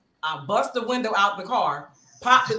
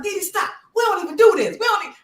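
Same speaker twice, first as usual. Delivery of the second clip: higher, urgent, breathless plea; pleading mid-scuffle; 10 semitones higher, 4 dB louder than usual. Retold or imitated. To Javon while tussling over the phone, high urgent plea. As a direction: beg him to stop while wrestling.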